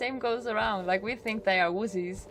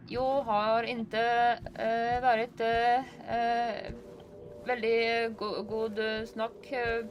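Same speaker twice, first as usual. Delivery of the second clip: slow, halting Swedish with hesitations; Swedish accent; 3 semitones higher, much slower than usual. Shown as a demonstration. Norwegian vlogger attempting Swedish, slow and halting. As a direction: fumble through Swedish, slowly. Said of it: "just hard doing their accent".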